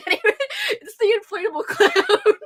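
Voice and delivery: high, through laughter; laughing. Real, not acted. Still laughing over the song, high wobbly voice. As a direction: get the words out while still cracking up. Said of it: "I don't know why I'm crying".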